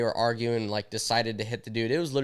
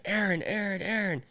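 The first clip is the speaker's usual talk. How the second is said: higher-pitched urgent plea, retold; put-on higher voice, pleading to leave; imitating wife; 7 semitones higher than usual. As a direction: imitate a wife urgently warning her husband. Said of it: "She's like".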